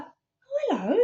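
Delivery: high, swooping surprised hello; retold startled greeting, starting way up; taken aback. Greeting a stranger who popped out of nowhere, mock surprise.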